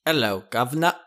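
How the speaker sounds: put-on lightly done posh British accent; a hint of a posh British gent saying Hello, governor; a quick jokey posh British voice; mock posh British accent. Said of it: "posh British accent".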